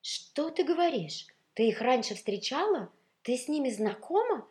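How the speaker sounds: high, loud, amazed gossip; excited, incredulous prying; clamouring questions. A narrator's reading. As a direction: fire questions like an eager crowd. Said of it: "наперебой шуршали сарафаны и пиджаки".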